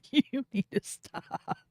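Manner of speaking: high, soft, slow plea through giggles; squeezed out between laughs; helpless laughter. Real, not acted. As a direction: beg weakly while cracking up.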